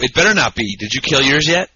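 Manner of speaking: ordinary talk, nothing marked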